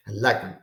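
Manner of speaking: "I like them" low and quick, "them" shrunk to 'em; them reduced. Shown as a demonstration. Teacher repeating the natural English way, them unaccented. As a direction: stress "like" and attach a weak "them". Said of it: "you only hear the first accent".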